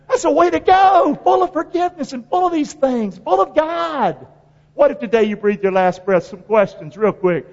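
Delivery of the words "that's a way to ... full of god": high ringing preacher's praise, slowing at the end; exalted preaching. Real, not acted. Preacher praising Stephen's death, high exalted voice. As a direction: sing it out, full of awe.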